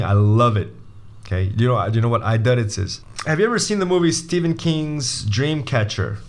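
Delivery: normal talking voice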